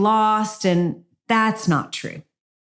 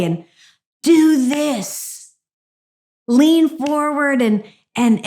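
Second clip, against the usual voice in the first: joyful God; 4 semitones higher than usual. Retold or imitated. God eagerly coaching his daughter on the court, joyful and high. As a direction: cheerfully hand over the perfect play. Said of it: "he so joyfully".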